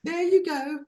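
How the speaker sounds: high-pitched caricature, feeding someone; put-on feeding voice. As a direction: play her in a silly high voice. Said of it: "She doesn't even talk like that".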